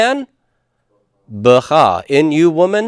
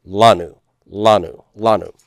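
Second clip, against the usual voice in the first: lanu repeated as LA-nu, falling each time; first-syllable stress; 4 semitones lower than usual. Shown as a demonstration. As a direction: drill lanu with the weight on LA. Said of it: "put that accent mark on the lamed".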